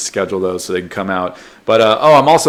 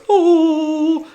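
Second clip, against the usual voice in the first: high, held angelic "ooh"; imitated heavenly "oh". Jesus statue opening his arms, high held note. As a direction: sustain one high note like a heavenly choir. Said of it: "It goes".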